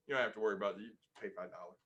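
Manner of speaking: imitating a hushed, breezy billing clerk; soothing billing clerk. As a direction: caller mimics the hospital clerk's soft brush-off. Billing office voiced by caller.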